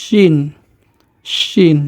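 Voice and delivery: শিন opened with a long sh hiss; শিন as "shhhin"; snake-like SH. Shown as a demonstration. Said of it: "সাপের মতো একটা আওয়াজ হবে".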